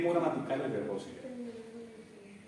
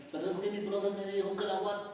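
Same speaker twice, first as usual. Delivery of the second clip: higher-pitched mock student voice; imagined complaining student; 6 semitones higher than usual. Student abroad blaming the teacher's lesson, mock complaint. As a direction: protest like a pupil quoting the teacher. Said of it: "no se estrechen y digan".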